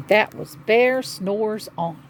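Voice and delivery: ordinary delivery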